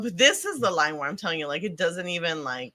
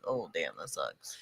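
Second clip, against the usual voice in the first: low, quiet and unbothered; flat indifferent; 13 dB quieter than usual. Retold or imitated. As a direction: shrug it off in a low mumble. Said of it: "to be like".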